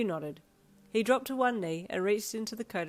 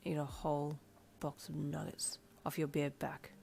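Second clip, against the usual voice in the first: soft unvoiced whisper; 3 semitones lower, 7 dB quieter than usual. A narrator's reading. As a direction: whisper it in her ear. Whispered punchline in her ear.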